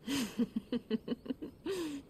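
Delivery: soft amused laugh. Acted. Laughs at his insult.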